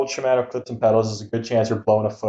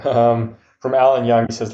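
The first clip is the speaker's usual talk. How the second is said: ordinary talk, nothing marked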